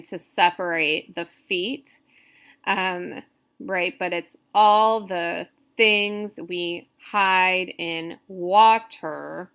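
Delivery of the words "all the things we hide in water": "all the things we hide in water" very slow, word by word; slow, deliberate beats on "all the things we hide in water"; scansion reading. Shown as a demonstration. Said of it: "they recommend reading it out loud".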